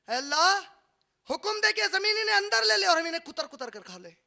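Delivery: high, loud altered voice of the fish; fish pleading. Acted. The fish beg God to let them eat sinners, loud pleading. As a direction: voice the hungry fish begging God. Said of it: "मच्छियां कहती हैं".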